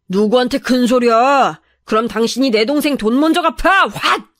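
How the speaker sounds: gruff angry brother talking back; menacing retort; threatening. Acted.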